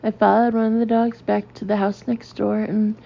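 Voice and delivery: ordinary delivery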